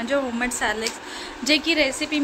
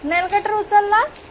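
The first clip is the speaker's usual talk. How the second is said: calling out; 7 semitones higher, 8 dB louder than usual. Real, not acted. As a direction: call across the room, half teasing. Mother calls baby about nail cutter.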